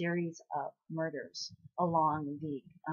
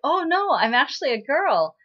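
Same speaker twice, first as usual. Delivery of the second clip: higher, louder mock-flustered correction; flustered protest; 8 semitones higher, 9 dB louder than usual. Girl mistaken for a boy correcting the fortune teller, flustered protest. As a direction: blurt out an acted, startled denial.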